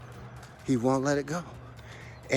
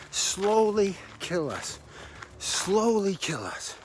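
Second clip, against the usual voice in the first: slow emphasis; 5 semitones higher, much slower than usual. Real, not acted. Repeated slowly for emphasis.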